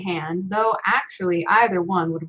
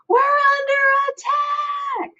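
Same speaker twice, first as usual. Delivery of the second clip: high, slow, drawn-out panicked holler; hollered alarm; slower than usual. Acted. Uncle convinced the family is under attack, hollering. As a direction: bellow the warning to the whole room. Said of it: "he hollered".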